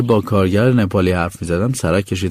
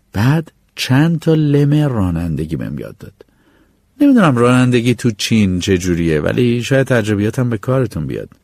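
ordinary talk

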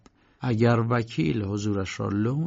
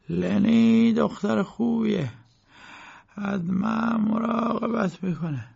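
put-on slow, higher, ailing old man's voice; sick old lawyer; 9 semitones higher than usual. A narrator's reading. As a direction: complain slowly like a bedridden old man. (صدای کند پیرمرد بیمار)

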